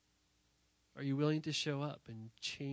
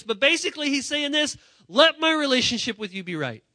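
loud, high, impassioned preaching; full-force preaching; 10 semitones higher, 14 dB louder than usual. Acted. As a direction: preach it out to the whole church.

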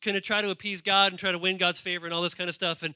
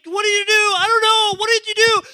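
much higher, louder angry yelling; furious king; 13 dB louder than usual. Acted. The king demanding answers from Balaam, a loud angry yell. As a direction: scream at him in disbelief. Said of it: "he's like".

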